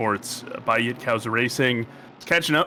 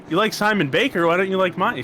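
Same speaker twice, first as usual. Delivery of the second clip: raised, louder playful complaint; higher, louder mock hurt plea; mock indignant protest; 7 semitones higher, 4 dB louder than usual. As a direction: protest like a sulky boyfriend. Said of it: "I was like".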